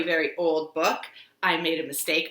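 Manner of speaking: ordinary delivery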